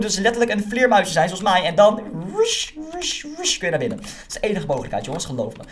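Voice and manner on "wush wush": high airy whoosh sounds; whooshing bat noise. Pretend. Showing viewers how a bat gets inside, high whoosh. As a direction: swoosh like a bat darting in.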